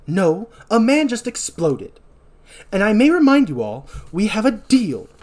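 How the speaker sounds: high, loud theatrical correction; emphatic retort. Acted. Doctor Mixer corrects Sister Catherine, loud and emphatic. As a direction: correct them imperiously, stretching the words. Villain corrects and presses the group.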